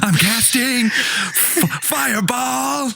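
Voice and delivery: high, drawn-out overacted cry; Nicolas Cage. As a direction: stretch it into a high, unhinged wail. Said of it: "but you're Nicolas Cage".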